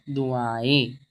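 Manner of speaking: दुआएं with only a faint n-like nasal at the end; light nasal ending. Shown as a demonstration. Teacher modelling the light sound, दुआएं ending softly nasal. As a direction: nasalise the last vowel of दुआएं, no full n. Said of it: "नून की तरह हल्का सा sound".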